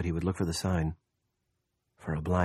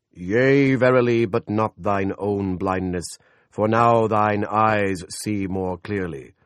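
God's voice; 2 semitones higher, 7 dB louder than usual. A narrator's reading. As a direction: thunder it down from heaven. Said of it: "The Lord answered".

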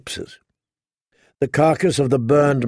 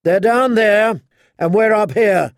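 high, loud mocking challenge; rallying shout; 7 semitones higher, 4 dB louder than usual. A narrator's reading. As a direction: rouse the troops with scorn. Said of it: "He was shouting now".

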